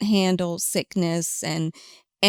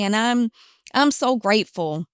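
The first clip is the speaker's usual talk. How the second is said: ordinary talk, nothing marked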